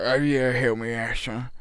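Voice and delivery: garbled, mumbled grumble; slurred grumble, words barely formed; grumbling old man. Retold or imitated. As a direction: imitate a man grumbling unintelligibly. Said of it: "he was grumbling. He was like".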